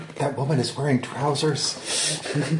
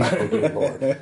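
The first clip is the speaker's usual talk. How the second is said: laughing; slower than usual. Real, not acted. Self-mocking about accent.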